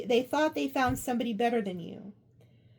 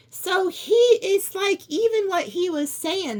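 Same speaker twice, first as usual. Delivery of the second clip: excited; 6 semitones higher, 5 dB louder than usual. Real, not acted. Reader connecting her friend's words, excited. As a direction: lean in, almost breathless.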